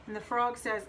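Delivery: usual speaking voice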